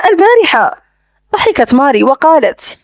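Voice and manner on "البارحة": teasing question, pitched up; joking. A narrator's reading. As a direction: echo it as a playful query. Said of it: "سأل بلهجة مازحة". (سؤال مازح بنبرة أعلى)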